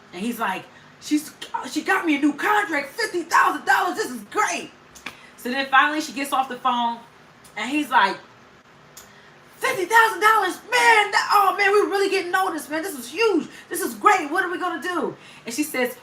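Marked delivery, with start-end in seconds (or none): 1.0-4.7 excited husband. Retold or imitated. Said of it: "He's so happy and so excited". 9.6-15.2 high-pitched, over-the-top mimicry of a thrilled husband; excited husband. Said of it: "He's so happy and so excited".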